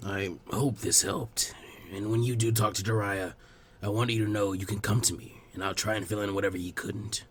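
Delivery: put-on gentle, low old village elder; old Hokage. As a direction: speak like a patient elderly leader. Hiruzen voiced lower.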